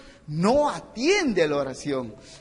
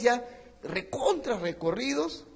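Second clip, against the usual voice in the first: usual speaking voice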